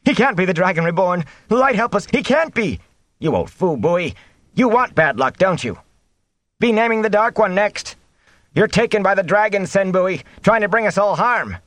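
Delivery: high-pitched, panicky villagers' protests; crowd outcry. A narrator's reading. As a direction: cry out in alarm and accusation. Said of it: "Sen had kindled the crowd again".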